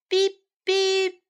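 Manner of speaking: high beep beep like a horn; car horn beep. Acted. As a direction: toot it like a toy car. Imitating a passing car horn.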